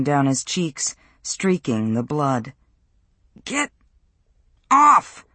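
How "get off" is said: loud, high burst on OFF; struggling shout. A narrator's reading. Peter pinned down by Matt, desperate shout. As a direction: shout to shove an attacker off. Pinned boy fights off bully.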